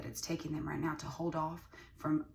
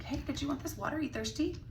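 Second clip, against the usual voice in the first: friendly stranger voice; 10 semitones higher than usual. Retold or imitated. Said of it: "you know, like".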